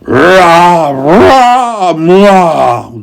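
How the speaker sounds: loud, high snorted roars; a charging bull blowing; angry bull snorting. A narrator's reading. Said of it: "snorted the angry bull".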